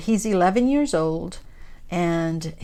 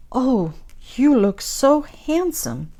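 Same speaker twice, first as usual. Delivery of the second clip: put-on higher, drawn-out gushing over her child; admiring mom; 6 semitones higher, slower than usual. Mom seeing her son in his little suit, admiring. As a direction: imitate a proud mom's slow, lilting coo. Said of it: "and the mom says".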